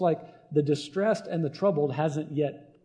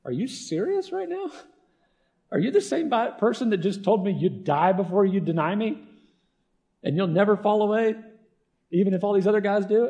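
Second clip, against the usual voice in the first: incredulous rebuke; 5 semitones higher than usual. Retold or imitated. Jesus scolding sleeping Peter in incredulous outrage. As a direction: ask Peter in sheer disbelief.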